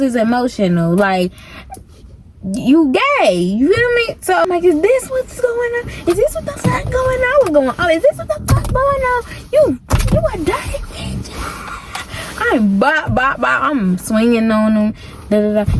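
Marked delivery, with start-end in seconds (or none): 4.6-11.3 high, furious disbelief; high outraged accusation. Retold or imitated. Betrayed girlfriend yelling at her cheating man. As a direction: call him out in outrage.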